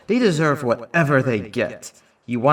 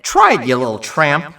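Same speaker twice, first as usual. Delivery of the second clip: louder, scornful dare; sneering taunt; 5 dB louder than usual. Acted. Danny taunts Kitty to hit him, sneering and loud. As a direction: spit the insult at her.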